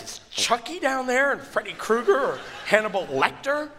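high, loud, laughing through the mock terror; laughing half-scared rant; laughing panic. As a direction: ham up the horror-movie fear. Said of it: "I'm like".

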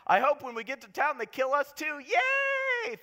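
high, loud sarcastic cheer ending in a shrieked yay; mock cheerful doom. [name] cheering about being killed in town, mock glee. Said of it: "They're just like".

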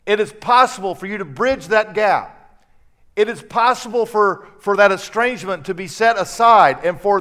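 loud, rising preacher's build to a climax; preaching at full force. Acted. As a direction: preach it out to the whole congregation.

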